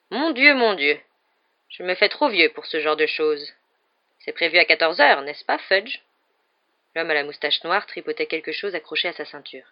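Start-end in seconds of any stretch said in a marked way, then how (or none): 0.1-6.0 lightly voiced frail old man; a hint of an aged, feeble voice; feeble old wizard. A narrator's reading. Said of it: "dit d'une voix faible".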